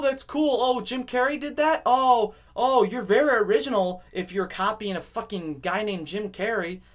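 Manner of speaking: high, mock-impressed put-down; hammed-up sarcastic gushing; sarcastic admiration. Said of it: "I was like".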